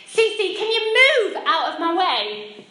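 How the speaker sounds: high, loud, then slow and pointed; mother snapping at her child to move, loud and high; sharp irritated bark. Retold or imitated. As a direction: shove the words at someone in your way. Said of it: "It doesn't sound great".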